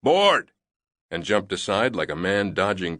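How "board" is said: high, loud barked order; yelled command. A narrator's reading. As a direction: yell it at the crowd. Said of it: "The loadmaster yelled".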